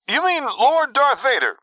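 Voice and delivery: very high, slow disbelief; shocked squeal. A narrator's reading. Quinn in shock at the name.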